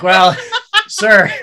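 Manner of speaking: high, loud laugh breaking into words; amused clerk laughing. Retold or imitated. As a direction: laugh out the word sir. Clerk finds peso, laughing.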